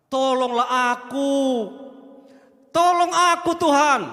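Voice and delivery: high, loud, slow begging; kneeling woman begging Jesus for help; humble desperate plea. Acted. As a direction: plead on your knees.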